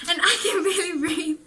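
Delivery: giggling out the lyric; laughing. Real, not acted. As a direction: break into a laugh mid-line. Laughs while quoting lyric.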